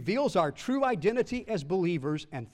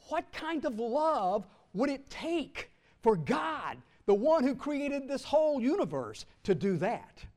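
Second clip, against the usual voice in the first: impassioned cry; 6 semitones higher than usual. Real, not acted. Preacher's climactic question.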